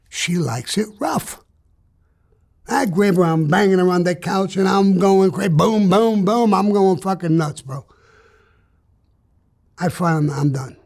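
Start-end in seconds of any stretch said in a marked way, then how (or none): 5.5-6.5 pounding booms. Retold or imitated. Imitating pounding.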